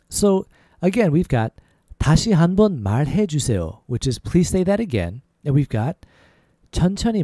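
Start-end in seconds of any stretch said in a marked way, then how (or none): none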